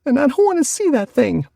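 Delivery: put-on high wailing child's cry; a little girl bawling in fear. A narrator's reading. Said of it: "bawled Connie".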